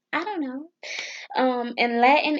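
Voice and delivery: usual speaking voice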